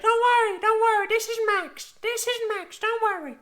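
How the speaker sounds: squeaky imitation of the old lady; high old lady voice. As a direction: soothe him like a sweet granny. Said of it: "She's like".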